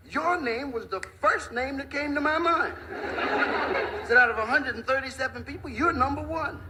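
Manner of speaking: high-pitched, hammed-up little tough guy; cocky taunting. Little fellow telling big guy he tops the list, taunting.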